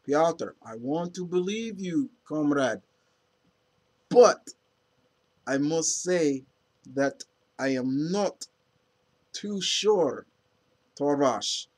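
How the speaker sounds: hammed-up Russian accent. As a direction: play a Russian comrade pleading in Russian accent. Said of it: "and I'm speaking in a Russian accent".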